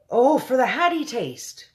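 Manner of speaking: high, slow cry of a giant; a giant exclaims in dismay, high and drawn out; BFG crying out. Acted. The giant cries out to the generals, high and slow. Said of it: "cried the B F G".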